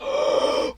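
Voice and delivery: voiceless mock gasp of shock; crowd's gasp. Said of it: "everybody in the crowd is just like".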